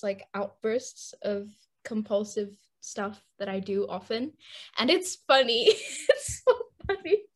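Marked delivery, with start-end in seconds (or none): 4.8-7.2 laughing. Real, not acted. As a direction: voice rising as giggles take over. Laughing while introducing her anecdote.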